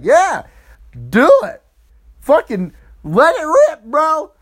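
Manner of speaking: high, loud hyped-up urging; eager egging-on. Retold or imitated. Women cheering Cam on at the orgy, excited urging. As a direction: egg him on like a rowdy crowd. Women egg him on, retold.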